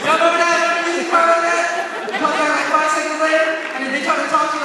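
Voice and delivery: loud, high excited shout. Acted. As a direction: shout it to the crowd. Excited comedic build.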